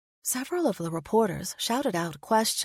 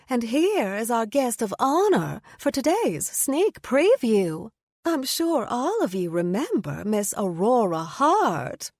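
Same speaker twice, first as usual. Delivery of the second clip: 1 announcer voice; 4 semitones higher, 4 dB louder, slower than usual. A narrator's reading. Mistletoe announcing guest to crowd.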